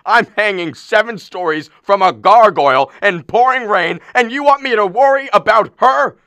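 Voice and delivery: husband drenched on the ledge, outraged at his wife. Acted. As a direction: blow up at her.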